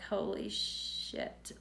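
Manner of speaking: slow, breath-only retold curse; stunned disbelief. Patient just told she has cancer, stunned slow whisper. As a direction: drag it out very softly, barely voiced.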